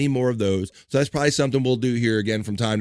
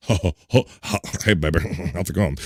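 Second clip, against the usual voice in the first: lower, faster fake grown-up voice; 7 semitones lower, slower than usual. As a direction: kid faking a deep grown-up voice. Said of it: "put on a grown-up voice".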